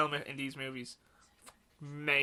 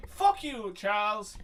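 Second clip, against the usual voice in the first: put-on high, loud snarl at an old friend; Magneto impression; 5 semitones higher, 7 dB louder, much slower than usual. Mimicking Magneto telling off Charles, loud sneering impression. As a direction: spit it at him, higher and louder. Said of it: "Love Michael Fassbender goes, as Mister Magneto".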